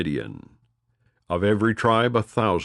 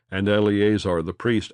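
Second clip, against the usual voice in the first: plain reading voice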